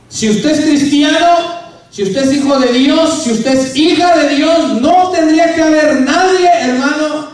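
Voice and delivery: loud, slow, rising pulpit delivery; fervent preaching. Acted. Preacher urging the congregation to serve God, fervent and loud. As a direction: drive the verse home to the congregation.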